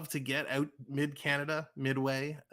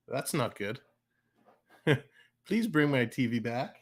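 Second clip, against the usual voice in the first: normal talking voice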